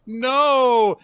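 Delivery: high, loud drawn-out no; desperate mock cry. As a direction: wail it to stop a disaster. Imitates Anya stopping cake being thrown.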